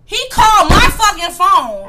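loud, high-pitched indignation; indignant outburst. Real, not acted. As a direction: burst out in real outrage. Indignant about the missed birthday wish.

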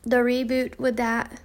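ordinary delivery

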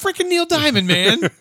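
high, loud incredulous cry; exasperated outburst. Real, not acted. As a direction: burst out in comic disbelief. Ranting about English football songs.

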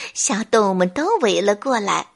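ordinary delivery, nothing marked

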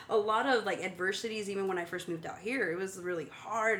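ordinary delivery

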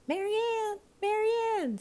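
very high calling voice; rabbit calling. A narrator's reading. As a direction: call out high, as the bossy rabbit. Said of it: "said the voice".

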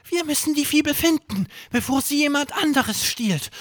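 hohe, schnelle Kinderstimme; drängend und hoch, wie ein aufgeregter Junge; Erik Stimme. Acted. Erik spricht in Geschichte. (hohe, eilige Jungenstimme)

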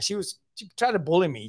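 normal talking voice

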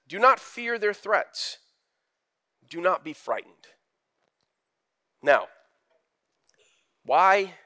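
ordinary delivery

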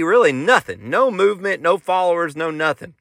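normal talking voice

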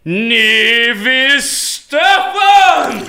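slow, loud, high showbiz announcement; announcer call. Acted. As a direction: present her like a star. Announcing the guest. (anunciando com pompa)

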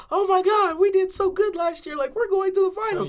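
mocking excited Knicks fans; imitating Knicks fans. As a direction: parody an overexcited fan. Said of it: "they were like".

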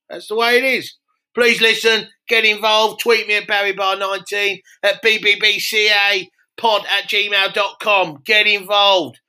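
hammed-up landlord plugging his pub; Barry Barr voice. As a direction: plug the show like a loud pub landlord. Barry Barr character voice held.

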